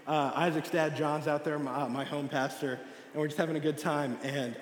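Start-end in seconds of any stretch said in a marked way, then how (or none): none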